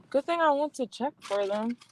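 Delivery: ordinary delivery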